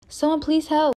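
high cry for help; calling for help. Acted. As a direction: call out to the stranger. Tied girl calling out.

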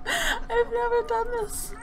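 high, slow, teary wail; hammed-up crying. Practicing acting, fake-cries that it's a first. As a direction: overact tears of joy. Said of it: "trying to practice my acting".

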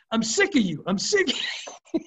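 high-pitched mock outburst, climbing on the repeat; mock pissed off. Fishing buddy sick of being told what to do, mock pissed off. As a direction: imitate a fed-up buddy, pitch jumping up. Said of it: "He's all pissed off".

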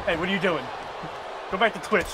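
ordinary talk

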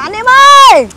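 loud, high toast call; rally everyone to drink. Real, not acted. Toast chant before drinking. (hô to gọi mọi người)

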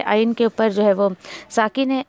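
ordinary talk, nothing marked

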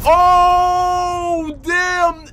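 long, loud, high drawn-out "oh"; stunned, high-pitched exclamation held long; shocked outcry. Real, not acted. Reacting to shock in video.

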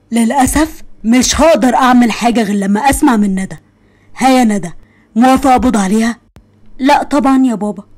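normal talking voice

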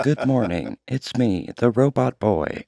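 put-on flat mechanical robot boy voice. Shown as a demonstration. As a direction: speak like a stiff robot. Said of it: "Whoa, that was robot-like".

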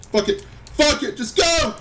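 high, loud urgent yelling; frantic yell. Real, not acted. Gamer yelling at his stuck character, frantic. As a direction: yell at it to move.

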